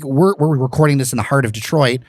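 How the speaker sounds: ordinary delivery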